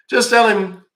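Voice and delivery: excited. Real, not acted. Excited instruction to caller.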